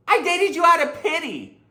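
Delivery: high, loud offended snap; indignant retort. Acted. Dumped girlfriend snapping back at the boyfriend, high and loud. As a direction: fire back, stung and huffy. Acted breakup quarrel.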